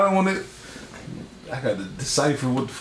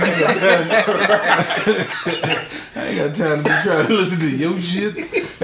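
slow, laughing and drawn out; 4 semitones higher, slower than usual. Real, not acted. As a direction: drag it out through laughter.

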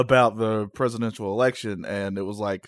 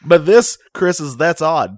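announcer; 5 semitones higher, 9 dB louder, slower than usual. Acted. Announces the show title.

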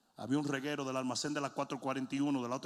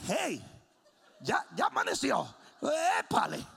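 a hint of a child calling out; lightly voiced little girl; 9 semitones higher, 5 dB louder than usual. Preacher imitates the girl waking everyone, lightly mimicked child.

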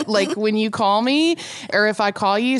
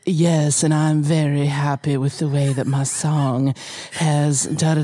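[name] impression; 6 semitones lower than usual. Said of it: "cross between, like, Southern".

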